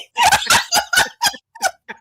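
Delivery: high shrieking giggle; squealing laugh. Real, not acted. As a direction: cackle uncontrollably. Laughs at own rant.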